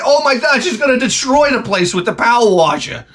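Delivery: high-pitched, hammed-up alarm; mock panic. Husband watching his wife with power washer, mock panic. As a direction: wail in comic horror. Acting out haunted house terror.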